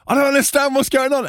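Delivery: high, bewildered mock wail; mock panic. Performer turning to bandmates, confused, high mock panic. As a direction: act out rising bewilderment.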